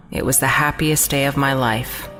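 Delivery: ordinary delivery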